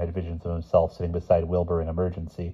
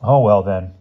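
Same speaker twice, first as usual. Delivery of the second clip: mildly flustered, loud backing down; taken aback; 2 semitones higher, 9 dB louder than usual. A narrator's reading. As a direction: back down, a little thrown. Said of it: "stammered Bruno".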